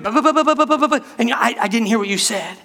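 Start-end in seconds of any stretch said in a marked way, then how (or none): 0.0-1.0 high, rapid-fire babble; mock fast talker. Preacher imitating his excitable friend, mock fast talking. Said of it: "This girl talks so fast".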